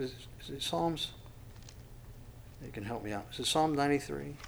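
ordinary talk

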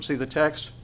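normal talking voice

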